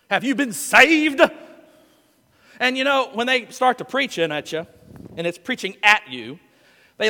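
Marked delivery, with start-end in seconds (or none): 0.1-1.2 mock evangelist. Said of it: "when they ask that question".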